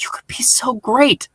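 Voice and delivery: retold line in a soft whisper; hushed, coaxing whisper; whispered plea. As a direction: breathe it out as a tempting whisper. Retold scene, she tempts the Master.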